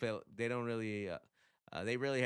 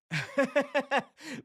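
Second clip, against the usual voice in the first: high, loud chuckle; amused laugh; 14 semitones higher, 8 dB louder than usual. Real, not acted. Host amused [name] lost to [name], amused laugh. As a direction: crack up briefly.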